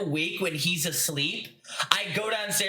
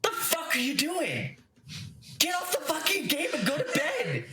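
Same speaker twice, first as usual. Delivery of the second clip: mock angry dad; 8 semitones higher than usual. Dad opening the door at two AM, mock angry high scolding. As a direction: scold like a dad catching a late gamer. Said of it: "my door open and go".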